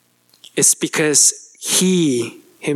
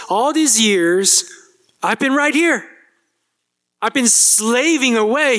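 higher, aggrieved complaint about fairness; indignant older son; 7 semitones higher than usual. Acted. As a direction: voice the son's wounded protest, pitched up. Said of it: "There's anger in the older son's voice".

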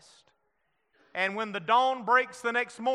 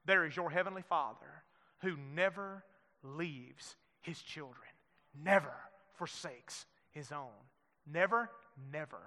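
much softer, lower and intimate; hushed reassurance; 6 semitones lower, 11 dB quieter than usual. Acted. Preacher assuring the grieving church in a hushed voice. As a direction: lean in and comfort them quietly. Sermon drops to a hush.

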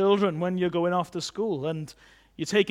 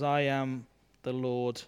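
God speaking; 6 semitones lower, 5 dB quieter than usual. Acted. God reassuring an insignificant-feeling listener, low solemn assurance. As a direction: speak softly with measured pauses. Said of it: "he says".

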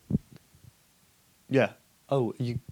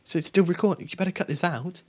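panicked; 6 semitones higher, 5 dB louder than usual. Real, not acted. Panic about recording.

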